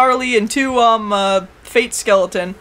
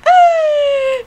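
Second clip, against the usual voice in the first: long high-pitched squeal; mock squeal; 4 dB louder than usual. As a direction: imitate a drawn-out cartoon squeal. Voices Kuguru's squeal on arrival.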